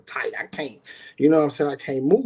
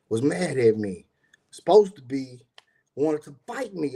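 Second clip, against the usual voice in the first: normal talking voice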